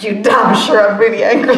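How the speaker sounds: mock-furious retort, laughing; loud laughing mock anger. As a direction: bark it out but crack up. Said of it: "I was like".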